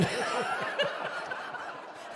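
breathless high-pitched giggle; laughter squeezing out; real laughter breaking the quote; high wheezing laugh. Real, not acted. Laughing mid-story.

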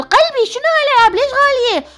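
high, startled cry at the prices; sudden high outraged surprise; shocked at prices. Real, not acted. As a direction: gasp it out, can't believe the cost.

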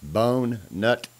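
ordinary delivery

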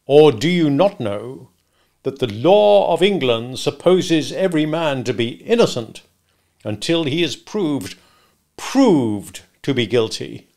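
slow, weighty courtroom questioning; pompous, interrogating stranger; bullying cross-examiner. Acted. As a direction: lean heavily on the words, lawyer-like. Stranger cross-examining Mister Wopsle.

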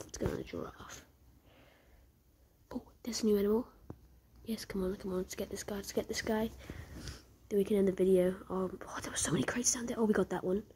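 ordinary talk

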